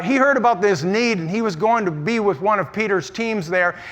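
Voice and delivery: ordinary delivery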